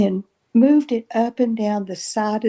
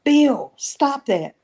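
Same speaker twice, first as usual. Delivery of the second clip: mild yell, higher and louder on the name; retold yell; 6 semitones higher, slower than usual. Sister yelling at Bill over tickled feet, retold yell. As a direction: call out sharply, imitating her yell. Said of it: "I yelled out real loud".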